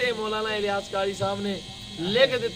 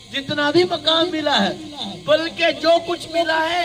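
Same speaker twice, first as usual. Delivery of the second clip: loud, raised-pitch quoted declaration; fervent preaching; 5 semitones higher, 5 dB louder than usual. Retold or imitated. Preacher quoting his leader crediting the holy family, fervent. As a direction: proclaim the quote with fervour.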